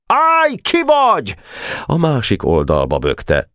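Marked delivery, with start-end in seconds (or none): 0.1-1.3 very high, stretched sentry's cry; high, slow-drawn halting call; overzealous challenge shout. A narrator's reading. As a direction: challenge them like an overeager sentry. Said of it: "a túlbuzgó tüzér rákiáltott".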